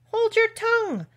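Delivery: very high, loud, outraged command; imperious outburst to silence someone; enraged. A narrator's reading. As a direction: shriek it like a furious storybook queen. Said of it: "turning purple".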